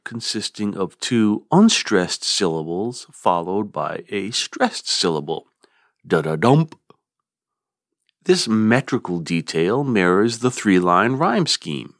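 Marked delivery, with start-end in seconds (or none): 6.0-6.8 da-da-dump, two light beats then a strong dump; anapest rhythm. Shown as a demonstration. Teacher demonstrating the anapest, stressing the final dump. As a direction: tap out da-da-dump, weight on the last dump. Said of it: "and an anapest".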